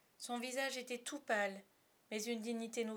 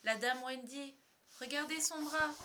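higher, rising amazement; excited cry; 6 semitones higher, 4 dB louder than usual. A narrator's reading. Bonzig points out Wendy's arm, excited. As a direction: urge everyone to look, pitch climbing. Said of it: "s'écria-t-il".

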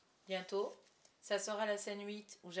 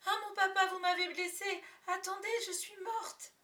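put-on very high, loud wounded child's cry; stage cry; 11 semitones higher, 6 dB louder than usual. A narrator's reading. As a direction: cry out as if struck, hammed-up. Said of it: "et je crie".

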